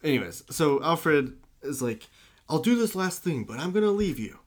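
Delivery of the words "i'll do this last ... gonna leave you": higher-pitched, lightly voiced butler; hammed-up plea, pitched well up; retold butler's ultimatum. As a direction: imitate a pleading butler giving an ultimatum. Retold butler threatening to leave.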